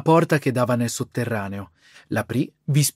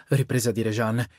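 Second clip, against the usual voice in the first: ordinary delivery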